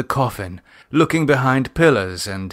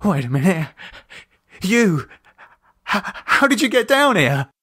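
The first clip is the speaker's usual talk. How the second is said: confused fear; 8 semitones higher than usual. A narrator's reading. David confused, suspicious.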